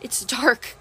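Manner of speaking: ordinary delivery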